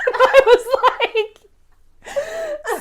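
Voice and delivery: real laughter breaking the words; laughing hard. Real, not acted. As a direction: crack up at the absurd excuse. Laughing at mother's excuse.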